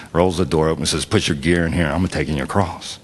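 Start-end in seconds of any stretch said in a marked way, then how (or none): none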